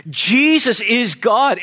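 normal talking voice

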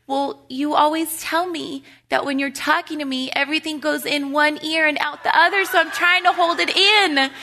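a lightly voiced sweet little boy; sweetly. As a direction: sound like a child sweetly explaining himself. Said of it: "says real sweetly".

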